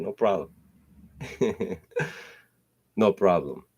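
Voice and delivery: normal talking voice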